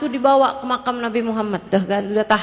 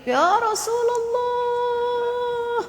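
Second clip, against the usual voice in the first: retold wailing cry; 11 semitones higher, 4 dB quieter, much slower than usual. Old man calls out to the Prophet, wailing. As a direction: stretch it into a long high plea. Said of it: "dia teriak".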